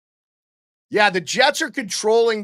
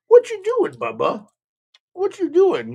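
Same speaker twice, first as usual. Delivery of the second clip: very high, louder squeaky pet voice; 5 dB louder than usual. Real, not acted. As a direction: tease the dog playfully, way up high. Talking to his dog.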